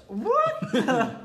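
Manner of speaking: high, loud, laughing outburst; startled disbelief. Real, not acted. Co-host reacts to odd pictures, startled disbelief. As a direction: burst out high, baffled by the sight.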